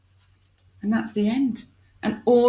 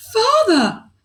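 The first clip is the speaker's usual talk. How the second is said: high, loud cry of Father; 7 dB louder than usual. Acted. Princess finding her father in the pot, loud high cry. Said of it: "cried Princess Spaghetti".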